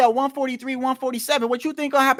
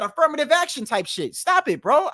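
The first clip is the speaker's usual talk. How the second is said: ordinary talk, nothing marked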